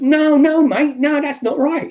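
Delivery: high, loud mock protest; protesting homeowner. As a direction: act out an alarmed householder objecting. Acting out protesting house owner.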